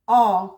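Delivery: "ऑ" low, loud and long, no r. Shown as a demonstration. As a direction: read OR as a pure "ऑ". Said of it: "तो क्या पढ़ेंगे".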